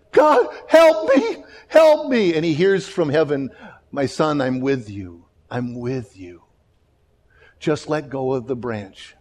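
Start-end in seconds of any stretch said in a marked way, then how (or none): 0.1-2.3 high, loud, slow cry for help; desperate call for help. Retold or imitated. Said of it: "he calls out". 3.9-9.1 calm voice from heaven. Retold or imitated. Voice from heaven in joke.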